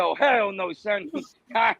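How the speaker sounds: usual speaking voice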